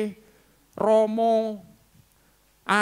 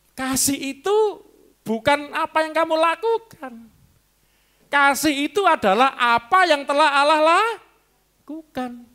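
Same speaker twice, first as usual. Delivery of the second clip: higher and louder, slow and drawn out; proclaiming preacher; 8 semitones higher, 6 dB louder, slower than usual. Acted. Preacher proclaiming to the congregation what love is, high and slow. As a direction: lift it up as the sermon's climax.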